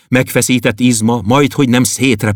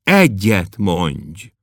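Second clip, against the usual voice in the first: pleading; much slower than usual. Acted. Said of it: "nézett rá könyörgőn".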